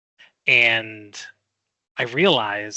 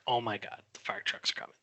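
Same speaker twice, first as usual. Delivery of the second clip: stunned whisper; 3 semitones lower, 13 dB quieter, faster than usual. Retold or imitated. Hears fire trucks, whispers.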